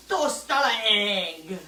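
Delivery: toast on an egg said slow in a mock French chef accent; a slow, hammed-up French chef accent, savouring each word; mock fancy chef accent. Teasing her partner about fancy chef names, in a slow mock French chef accent. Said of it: "'Cause chefs say everything with accents".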